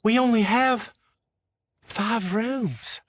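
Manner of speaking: put-on panicked old wife wringing her hands; worried old mama. Old wife to her husband about the rooms, fretting. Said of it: "She says".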